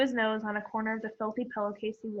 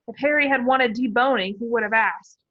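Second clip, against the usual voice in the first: ordinary delivery, nothing marked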